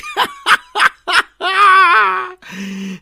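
high, loud cackling laugh; raucous laughter. Acted. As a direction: howl with mocking laughter. Bully roars with laughter. (harsány röhögés)

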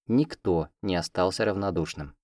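ordinary delivery

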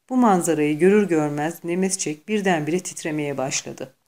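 ordinary delivery, nothing marked

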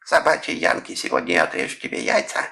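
mock a Japanese movie villain's threat; put-on Japanese accent sliding out of falsetto. A narrator's reading. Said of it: "высоким фальцетом, подражая речи японцев".

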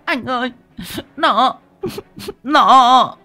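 high falsetto, halting; frightened whimper. A narrator's reading. Frightened wife pointing out the stranger, halting wail. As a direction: stammer to your husband, rising to a shriek.

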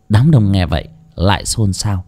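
usual speaking voice

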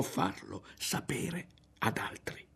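dropping into a whisper; whispered secret, trailing away; secretive. A narrator's reading. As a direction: confide it in a hushed whisper. Passing on a secret instruction.

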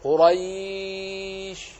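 قريش with open fatha before a soft ya, held long; drawn-out قريش with a long lin glide; soft ya in Quraysh. Shown as a demonstration. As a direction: stretch قريش slowly, keeping the vowel open. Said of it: "كذلك تقول في قريش".

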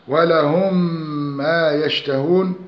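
slow recitation, ولهم ما joined by a held nasal m; tajweed merging with ghunna. Shown as a demonstration. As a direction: hum one long doubled meem from ولهم into ما. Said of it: "وجب الإدغام بغن مقدارها حركتان".

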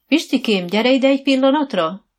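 loud, high summoning call; calling someone over. A narrator's reading. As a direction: call the stable hand over from afar. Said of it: "szólította meg Dóra az egyik lovászt".

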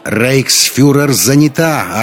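loud, high, drawn-out retort; yelling back. A narrator's reading. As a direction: bellow back at the heckler. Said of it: "орала в ответ Шарлотта".

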